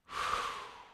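slow audible exhale through the mouth; long breath out. Shown as a demonstration. Preacher leads the room in breathing out with a long sigh. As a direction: let the air go, slowly. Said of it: "let's all just do that".

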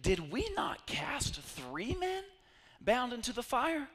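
slow, high, astonished king asking his counselors; a king in disbelief. A narrator's reading. King to his counselors, slow and astonished. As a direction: draw the question out in disbelief.

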